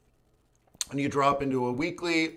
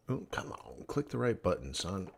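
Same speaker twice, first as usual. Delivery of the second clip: muttered under the breath, much softer; annoyed whispered aside; frustrated whisper; 5 semitones lower, 14 dB quieter than usual. Real, not acted. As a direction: grumble at the stubborn software. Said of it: "Now I know why I'm pissy".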